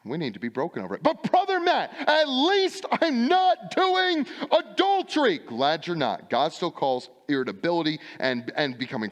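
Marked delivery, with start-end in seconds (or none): 1.0-5.4 high, slow, louder mock whine; a defensive churchgoer pleading his innocence; whiny excuse. Congregant excuse voiced by preacher.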